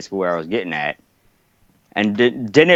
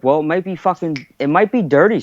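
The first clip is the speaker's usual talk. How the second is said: higher-pitched feigned guess; mock helpful suggestion; 6 semitones higher than usual. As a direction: offer it as a leading suggestion, pitched up. Coach baits lieutenant with false cause.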